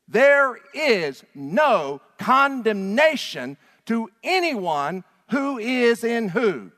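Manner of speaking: very high, loud, slow call-out; passionate shouting. Real, not acted. Preacher drilling the congregation, loud call-and-response. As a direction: shout each question at the crowd.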